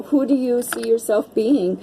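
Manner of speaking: ordinary talk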